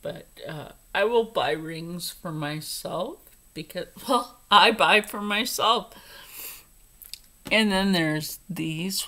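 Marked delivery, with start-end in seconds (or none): none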